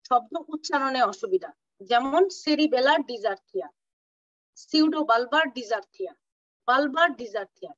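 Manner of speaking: usual speaking voice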